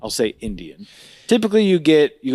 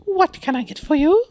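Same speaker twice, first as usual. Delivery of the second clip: high-pitched hammed-up waiter voice; waiter impression; 14 semitones higher than usual. Waiter greeting the table, hammed-up high voice. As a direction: pitch way up, a comic waiter's question. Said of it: "he comes up to a table and he goes".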